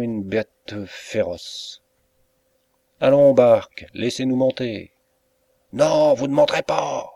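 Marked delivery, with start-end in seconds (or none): 3.0-4.9 loud, commanding call; firm call. A narrator's reading. Inspector orders Bark to open. 5.7-7.1 higher, louder angry snarl; angry refusal. A narrator's reading. Bark refuses the police.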